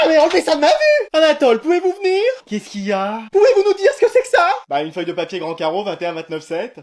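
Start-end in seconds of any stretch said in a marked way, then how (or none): 0.1-2.2 high-pitched melodramatic distress; horrified summons. Acted. Parent summons son over his report, melodramatic horror. 3.3-4.5 very high, indignant questioning; outraged demand. Acted. Parent confronts son with his report, outraged.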